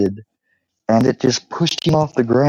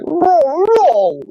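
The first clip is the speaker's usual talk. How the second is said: high, drawn-out dog howl; imitated dog howl; 4 dB louder than usual. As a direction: howl like a dog forming a word. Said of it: "it can do it with its vocal".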